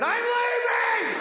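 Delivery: high, slow shouted exit line with a laugh; a laughing, mock-dramatic "I'm leaving!"; retold shout. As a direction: yell it high as you flee the room. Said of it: "I walked away and I said".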